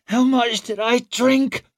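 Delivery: groggy woman croaking her question; croaked. A narrator's reading. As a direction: croak it out after waking from a stupor. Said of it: "she croaked out".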